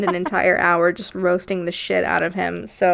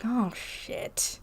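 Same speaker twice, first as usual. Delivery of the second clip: whispered oh shit; whispered dread; 10 dB quieter than usual. Retold or imitated. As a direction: breathe it out in dismay. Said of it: "the guy's like".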